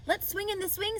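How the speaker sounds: put-on a boy bouncing with an idea; Avery, high and keen; Avery suggesting. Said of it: "said Avery".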